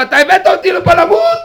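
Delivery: high, loud accusing cry; panicked yell. Retold or imitated. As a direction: yell it in terror at the rabbi. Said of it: "וצעקתי". (צעקת פחד מאשימה)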